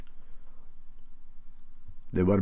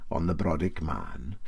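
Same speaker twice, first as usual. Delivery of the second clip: ordinary delivery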